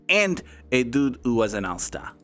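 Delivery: comic put-on French accent; terrible French accent. Streamer naming his grid answer in a terrible French accent. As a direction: keep the bad French accent going. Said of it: "[name]'s terrible French accent".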